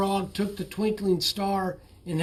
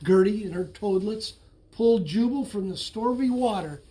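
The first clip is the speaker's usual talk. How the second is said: ordinary delivery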